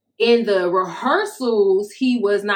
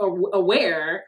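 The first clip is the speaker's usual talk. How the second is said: ordinary delivery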